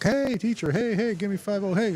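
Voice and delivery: very high-pitched imitation of eager kids; excited kids. Kids mobbing their teacher for a high five, high mock child voice. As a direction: greet teacher like a clamoring little kid.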